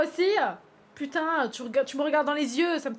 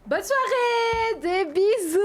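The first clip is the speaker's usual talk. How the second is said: high, slow, drawn-out mock farewell; sing-song goodbye; 8 semitones higher, 8 dB louder, much slower than usual. Streamer mockingly waves off the flirting character, slow sing-song. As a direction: stretch it out sweetly, teasing.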